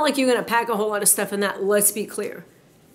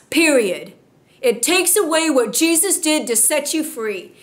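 fiery preaching; 5 semitones higher, slower than usual. Acted. Pastor rebukes complainers in the church, fiery preaching. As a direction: drive each phrase home to the congregation.

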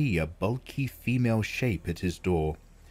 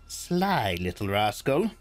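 chuckling. Acted. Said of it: "she chuckled".

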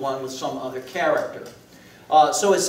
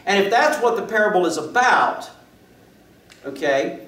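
higher, louder proclamation; fervent preaching; 6 semitones higher, 5 dB louder than usual. Acted. Preacher proclaiming the lost are sought, fervent. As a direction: proclaim it with rising passion.

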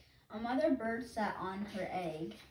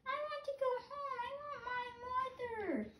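very high, fast then softer; a chick begging to go home; baby bird, homesick; 4 dB quieter than usual. Acted. Baby bird wanting its mother, high and pleading. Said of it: "my baby bird voice".

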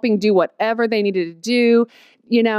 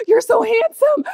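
gushing excitement; 11 semitones higher, 4 dB louder than usual. Retold or imitated. First meeting, gushing.

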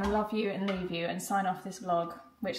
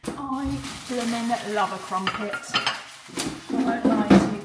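hammed-up British voice; 11 dB louder, slower than usual. Hammed-up crumpet line, loud.

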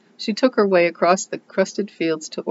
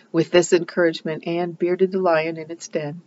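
plain reading voice

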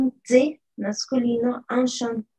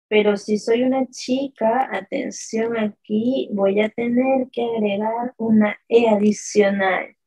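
ordinary talk, nothing marked

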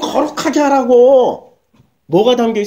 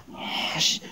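breathy whispered curse under the breath; 13 dB quieter, much slower than usual. Retold or imitated. Wife cursing under her breath at the sermon. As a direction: hiss it to yourself. Wife's inner worried grumble.

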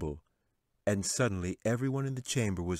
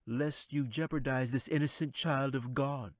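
calling out; 7 semitones higher than usual. A narrator's reading. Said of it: "Gelzuin called out".

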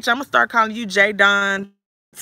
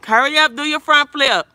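loud, higher-pitched parental call; 5 semitones higher, 7 dB louder than usual. Real, not acted. Parent urging child to show trick.

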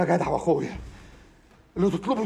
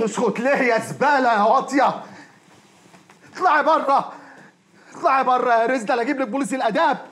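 loud, higher, scolding; angry eviction; 7 semitones higher, 4 dB louder than usual. Acted. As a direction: throw her out in front of his wife. Throws the woman out. (طرد بغضب وصوت عالٍ)

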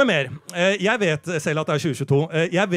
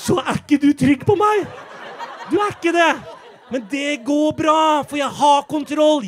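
shrill panic; 8 semitones higher, 6 dB louder than usual. Acted. Comedian shouting reassurance at the front-row woman. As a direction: protest control while clearly losing it. Overwrought reassurance of audience member.

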